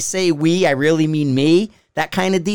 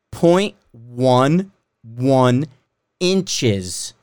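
slow emphasis; 6 semitones lower, much slower than usual. Shown as a demonstration. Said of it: "It's finer".

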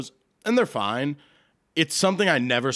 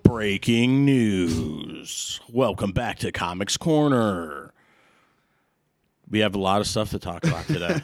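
news anchor voice; 3 semitones lower than usual. Acted. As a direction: read it like the evening news. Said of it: "That was all I had in news anchor voice".

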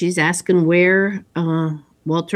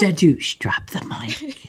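whispering; 4 dB quieter than usual. Pretend. Mock mic drop whisper.

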